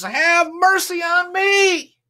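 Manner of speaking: high, loud, drawn-out plea; pleading shout. Acted. Blind beggar begging Jesus for mercy, loud and slow. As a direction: beg at the top of your voice, slowly.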